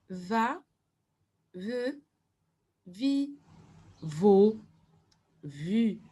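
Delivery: "va, ve, vi, vo, vu" one at a time, a clear pause after each; syllable by syllable. Shown as a demonstration. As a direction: model each syllable separately for learners to repeat. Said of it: "les syllabes". (syllabe par syllabe, avec une pause après chacune)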